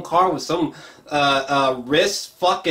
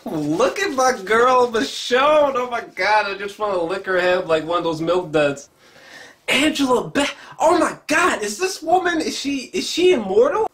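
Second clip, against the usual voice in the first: high, squealing gush over the actresses; giddy fangirling; 7 semitones higher than usual. Real, not acted. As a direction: gush like a starstruck fan, way up high. Gushing over actresses on screen.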